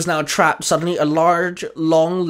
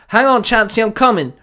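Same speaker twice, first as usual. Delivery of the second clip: higher, louder urgent call; 7 semitones higher, 5 dB louder than usual. Acted. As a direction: call out to someone who needs help. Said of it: "Baz called out".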